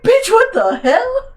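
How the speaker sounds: very high, loud, drawn-out disbelief; comically appalled high-pitched exclamation; shocked outrage. Retold or imitated. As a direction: squeal it slowly, hammed-up and scandalized. Acts out shocked reply to him.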